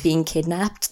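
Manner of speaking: ordinary talk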